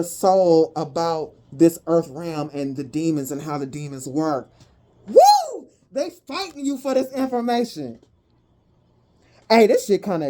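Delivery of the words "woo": high, loud whoop of excitement; excited whoop. Real, not acted. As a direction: let out a sudden joyful whoop. Excited outburst over the reading.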